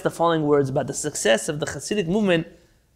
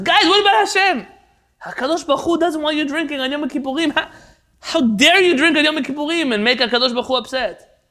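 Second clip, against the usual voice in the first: very high, loud outraged cry; 10 semitones higher, 5 dB louder than usual. Retold or imitated. Leibel invoking God against the drinkers, loud and high. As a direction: appeal to God in horror.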